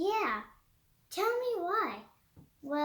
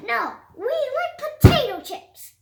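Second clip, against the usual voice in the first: loud, higher insistent shout; loud kid demand; 6 semitones higher, 10 dB louder than usual. Acted. Kids shouting at Petey for chips, loud demand. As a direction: yell it like a stubborn kid.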